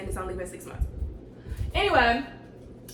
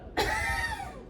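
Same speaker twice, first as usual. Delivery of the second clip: high laugh; 5 dB quieter than usual. Real, not acted. Laughing at quitting the job.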